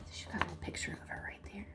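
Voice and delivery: fast hushed aside; whisper. Acted. Narrator quickly whispers to the child about the picture. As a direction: rush it out under the breath.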